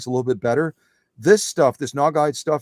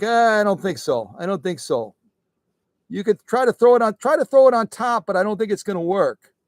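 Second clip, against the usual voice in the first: higher-pitched voice for a stubborn pile; talking fabric pile; 6 semitones higher, 4 dB louder than usual. Pretend. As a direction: playfully voice a doubtful heap. Voicing the toppled pile of sew-offs.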